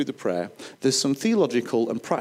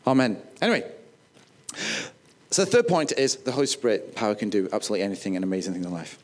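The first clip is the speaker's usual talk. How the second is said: ordinary talk, nothing marked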